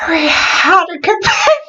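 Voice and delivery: silly high character squeal; awfully high voice. Pretend. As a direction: put on a very high, strained voice. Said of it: "Just give it an awfully high voice".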